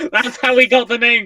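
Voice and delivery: laughing through a mock reveal. Real, not acted. Telling the listeners with a laugh. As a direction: chuckle the words out.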